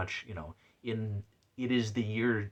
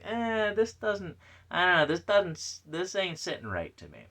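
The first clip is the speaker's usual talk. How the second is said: higher, slightly mock doubtful voice; sceptical reaction; 8 semitones higher than usual. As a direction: voice mild doubt as a quoted thought. Acts out own sceptical reaction.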